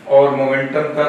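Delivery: usual speaking voice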